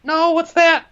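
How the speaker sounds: put-on squeaky high child calling for dad; high-pitched child voice asking dad; whiny little kid. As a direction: play a needy little child begging dad for answers.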